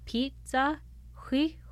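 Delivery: "pizza, Huihua", each word broken sharply in the middle; glottal stop between syllables. Shown as a demonstration. Teaching the glottal stop by likening it to pizza, "pizza, Huihua" split midway. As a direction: stop dead halfway through "pizza" and "Huihua". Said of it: "you have to stop in between".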